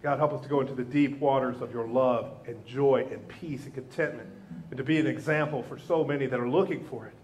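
low, earnest prayer voice; closing prayer. Acted. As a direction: lead the church in prayer, hushed and earnest.

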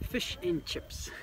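slow New Zealand "Fish and chips", vowels flattened toward "fush and chups"; Kiwi accent. Shown as a demonstration. Local teaching visitor the Kiwi way, in a New Zealand accent. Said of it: "I'm going to say this with a Kiwi accent".

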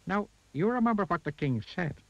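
high, pointed goblin warning. A narrator's reading. Goblin reminds Mister Uppity of the king's words, high warning. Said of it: "warned the goblin".

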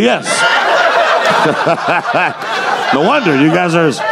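normal talking voice

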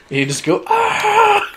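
sudden high-pitched ah; high yelp. Retold or imitated. Mocking ventriloquist lip-still yell.

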